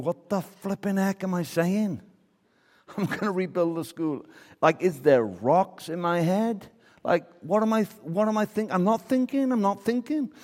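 high-pitched, hammed-up self-reproach; mock panic. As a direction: act out panicked disbelief, voice shooting up. Missionary's panicked thoughts, acted out.

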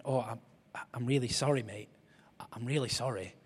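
soft, whispered sorry; sheepish apology. Retold or imitated. Lads apologising softly after hearing about grandma. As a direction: hush it apologetically. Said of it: "they went".

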